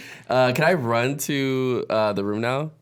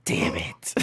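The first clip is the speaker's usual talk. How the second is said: muttered curse; 5 dB quieter than usual. Acted. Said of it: "He literally says it".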